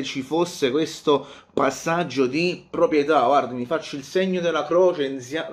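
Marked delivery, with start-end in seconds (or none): none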